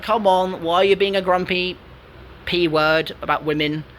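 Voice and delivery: usual speaking voice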